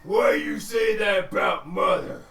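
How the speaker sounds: slow, loud ogre shout; angry ogre shout. Acted. An ogre defending his mother, slow loud shout. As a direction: bellow it like an offended ogre. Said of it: "and you hear".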